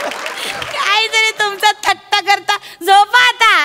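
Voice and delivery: high, drawn-out teasing scold; coy scolding. Acted. Coyly sends the admirer to sleep. (लाडिक, चढ्या सुरात दटावणे)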